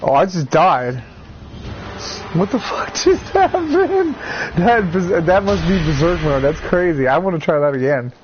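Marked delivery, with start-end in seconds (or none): none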